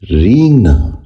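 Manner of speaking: رینگنا said "reengna", long "ee" and softened g; correct pronunciation. Shown as a demonstration. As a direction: open رینگنا with a drawn "reeng". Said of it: "دوبارہ سنیے".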